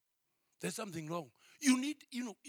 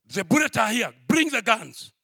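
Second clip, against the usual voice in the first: shouted orders, louder; mock police shout; 13 dB louder than usual. Police officer to the sleeping family, retold shouted orders. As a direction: bark the orders like a raiding officer.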